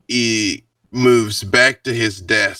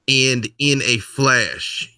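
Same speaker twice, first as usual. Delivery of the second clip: ordinary delivery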